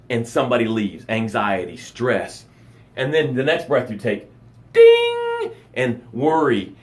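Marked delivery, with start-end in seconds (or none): 4.7-5.5 high sung ding like an elevator bell. Pretend. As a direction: ring it out like a lift chime. Elevator door opens again.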